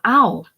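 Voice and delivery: loud, high "ow"; yelp of pain. Acted. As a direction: yelp as if your wrist is seized. Seized boy cries in pain.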